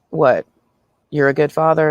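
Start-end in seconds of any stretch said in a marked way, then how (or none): none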